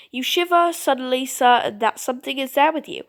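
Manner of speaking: ordinary talk, nothing marked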